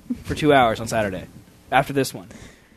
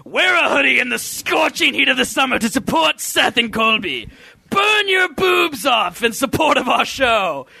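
high, drawn-out promotional holler; mock hype shout; 6 semitones higher, slower than usual. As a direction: belt it out like an over-the-top hoodie ad. Mock sales slogan for the show's hoodies.

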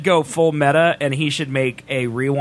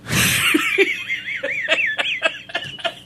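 high laugh. Real, not acted. Laughs at excuse.

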